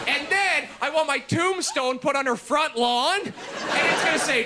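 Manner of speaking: ordinary talk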